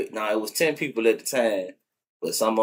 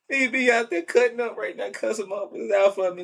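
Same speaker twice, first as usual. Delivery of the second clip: still tearful; 11 semitones higher than usual. Real, not acted. Still grieving, talking about dog.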